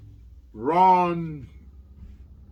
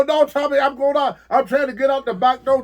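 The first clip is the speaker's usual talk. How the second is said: higher, louder frantic begging; retelling his own panic to his friend, higher and louder; panicked plea; 8 semitones higher, 5 dB louder than usual. Retold or imitated. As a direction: beg to be driven away, half laughing at yourself. Panicked retold plea in car.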